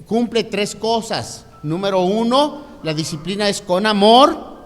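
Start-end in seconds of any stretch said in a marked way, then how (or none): 1.6-4.5 loud, high sermon delivery, counting out points; preacher building up to full force; preaching. Acted. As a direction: drive each point home to the congregation. Preaching three points of discipline.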